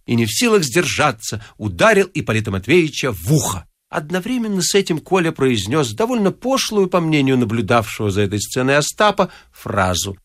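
plain reading voice

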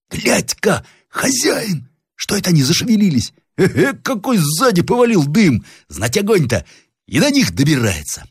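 high, alarmed pointing-out; high-pitched excited workman; excited young workman. Acted. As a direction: call the master's attention in a high voice. Story figure: excited young workman.

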